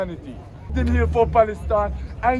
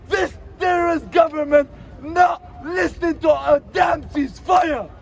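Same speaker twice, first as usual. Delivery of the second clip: raised, higher angry shouting at the government; 5 semitones higher than usual. Real, not acted. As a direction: yell out the frustration, pitch up. Angry rant against the government.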